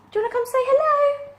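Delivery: high, fast cooing at the cat; high cooing to her cat Gracie. Real, not acted. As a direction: coax the cat over in a sing-song voice.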